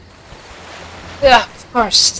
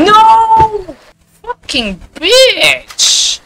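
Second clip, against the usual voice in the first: high raging scream; furious yell; 4 dB louder, much slower than usual. Real, not acted. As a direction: scream in fury. Raging at death.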